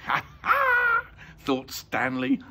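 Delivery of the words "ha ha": very high, short mocking laugh; gleeful "ha ha". A narrator's reading. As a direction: squeal a triumphant ha-ha. Stanley secretly mocks the thieves.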